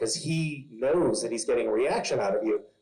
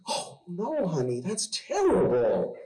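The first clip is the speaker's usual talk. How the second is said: mock parental dismay; 7 semitones higher than usual. Dad consoling daughter over friend's swearing, mock dismay. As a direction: act out a dad taking it very seriously.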